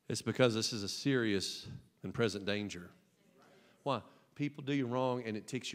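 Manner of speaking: low, soft, grave aside; hushed and serious. Acted. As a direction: bring it down to a hush.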